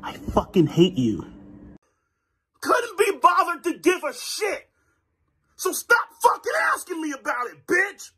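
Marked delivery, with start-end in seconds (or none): 2.6-8.1 shout. Acted. Speaker ranting at camera, shouting high. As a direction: yell it out high in disgust.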